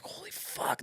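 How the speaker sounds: whispered in shock; awed whisper. Retold or imitated. Teen watching combat on the news, stunned whisper. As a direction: breathe it out stunned. Watching war footage in awe.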